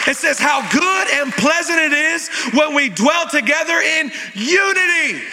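rally the church toward praise; full-force preaching. Acted. Pastor preaching to the congregation.

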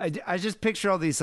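ordinary delivery

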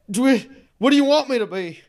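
high, fast, loud plea; frantic high-pitched question; panicked captive. Acted. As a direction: blurt it out in fearful confusion.

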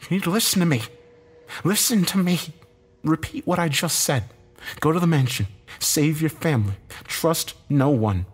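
high, emphatic commands in short bursts; ordering. A narrator's reading. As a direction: order him urgently, phrase by phrase. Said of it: "he ordered".

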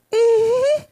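usual speaking voice

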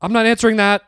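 high, loud, flat-out refusal; hammed-up defiant reply; acted refusal. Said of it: "I just look at him and say".

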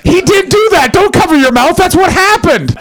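loud, high, laughing exclamation; laughing shout. Real, not acted. To the covering guest, a high loud laughing yelp. Shocked laughter at his own joke.

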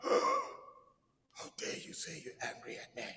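slow, scandalised whisper; mock outrage. God supposedly offended by an angry believer, mock outraged whisper. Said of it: "God is not like".

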